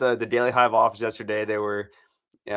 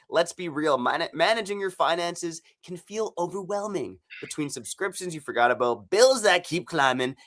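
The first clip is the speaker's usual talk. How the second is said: upbeat sponsor read, a touch higher; 4 semitones higher than usual. Acted. Host reading the Rocket Money spot to listeners, upbeat ad read. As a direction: sell it like a friendly podcast ad.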